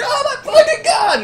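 very high, loud mock screech; freaked-out shriek. Game master as a woman freaking out, shrieking. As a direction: shriek it in hammed-up horror.

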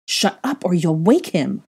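slow, firm order. A narrator's reading. Mother silencing him near the sleeping child, ordering. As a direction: order him slowly and firmly. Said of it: "Hermione ordered".

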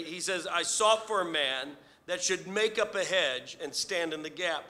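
rising preacher's fervor; preaching. Acted. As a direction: preach it with building force. Preaching builds in force.

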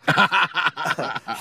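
short burst of laughter. Acted. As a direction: laugh at your own joke. Joking with his friend. (ضحكة قصيرة)

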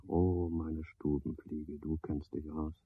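soft, intimate murmur to the fly; tender address. Acted. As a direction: coo it to the little fly.

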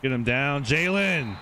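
loud yell, climbing high on the name; urgent yell. Real, not acted. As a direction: holler at your player mid-play. Yells at player during play.

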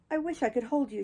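bitter. A narrator's reading. Catherine to Heathcliff, wishing them both dead, bitter. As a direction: spit out the longing bitterly. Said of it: "she continued bitterly".

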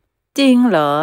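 surprised question. Acted. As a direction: ask in disbelief. Son surprised father doesn't know.

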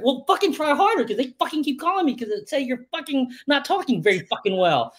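high, hammed-up scolding father; mock the dad's frustration; dad telling his son off, high mock anger; exasperated dad. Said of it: "And it's like".

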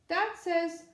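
loud, high urgent yell. Acted. Boy bursting into bedroom, yelling for bandages. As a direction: burst in shouting the news. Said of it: "he yelled".

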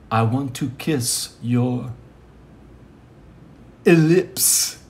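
a light hint of a dramatic romantic line; dramatic lover. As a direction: breathe it like a soap-opera lover, lightly. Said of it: "such dramatic tension".

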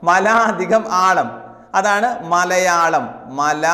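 each part drawn out; slow dictation. Shown as a demonstration. Teacher to exam students, slowly spelling out the split. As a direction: lay it out piece by piece for learners. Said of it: "teacher dictates the split form".

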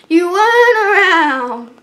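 stiff robotic singing. Shown as a demonstration. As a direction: sing it like a robot. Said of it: "I kinda sound like a robot".

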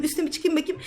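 ordinary talk, nothing marked

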